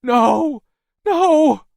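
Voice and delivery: very high, loud repeated cries; desperate, terrified shrieking; scream. A narrator's reading. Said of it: "Maisie screamed".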